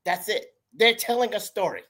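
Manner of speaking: ordinary talk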